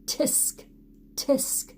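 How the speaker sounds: monkeys tutting back at the peddler. Acted.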